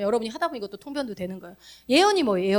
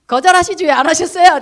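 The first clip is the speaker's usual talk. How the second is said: louder questioning push; retold loud question; 5 semitones higher, 8 dB louder than usual. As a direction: demand it of them loudly. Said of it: "아까 그 두 사람한테 그러잖아요". (큰 소리로 따지는 말투)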